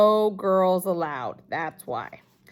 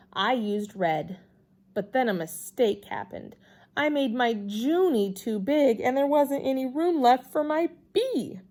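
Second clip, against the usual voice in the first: usual speaking voice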